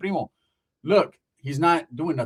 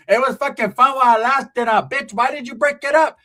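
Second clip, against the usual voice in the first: tia taunting; 6 semitones higher, 5 dB louder than usual. Retold or imitated. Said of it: "my tia's like".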